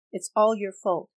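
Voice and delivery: loud, biting blame. Acted. Widow blaming the vampire for her dead daughter, loud. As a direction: hit him with it.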